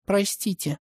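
soft, sheepish apology; mumbled. A narrator's reading. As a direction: murmur it under your breath. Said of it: "пробормотала Маргарет". (пробормотала)

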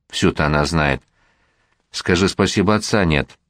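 plain reading voice

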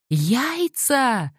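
high shout, far from narrator; yelling peasant. A narrator's reading. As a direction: cry out the word at the top. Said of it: "завопил крестьянин".

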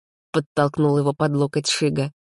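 ordinary delivery

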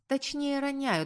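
ordinary delivery

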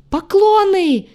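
loud high wail on the last word; drawn-out, high-pitched cry; wild yell. A narrator's reading. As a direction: break off into a wild shriek. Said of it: "С диким воплем влетел".

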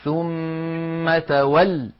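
very slow, chanted "ثم تول", final l pressed; وقف بالضغط على اللام. Shown as a demonstration. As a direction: stretch the m of "ثم", then stop hard on doubled l in "تول". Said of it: "على اللام المشددة هكذا". (الوقف على «ثم تول» بلام مشددة)

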